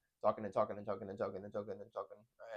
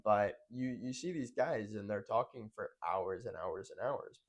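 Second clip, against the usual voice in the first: ordinary talk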